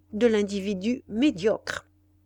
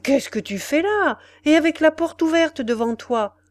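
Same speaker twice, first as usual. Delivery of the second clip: raised, higher-pitched reproach; indignant exclamation; 6 semitones higher, 4 dB louder than usual. Acted. Friend shocked at the open door, indignant exclamation. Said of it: "cria-t-il".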